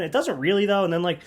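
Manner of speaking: normal talking voice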